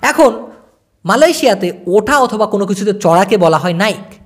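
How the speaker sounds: ordinary talk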